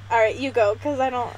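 normal talking voice

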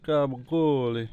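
hammed-up Italian accent, "Gabagool" slow and loud. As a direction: stretch "Gabagool" out big in a heavy Italian-American accent. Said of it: "Enough of my bad Italian accent".

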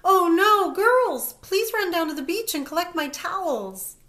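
put-on loud high yell calling the girls. A narrator's reading. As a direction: shout it out in a panic. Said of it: "Missus Feister yells".

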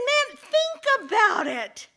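high, loud, drawn-out retort; exasperated dog. Acted. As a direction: make the man see sense. Dog berates the man.

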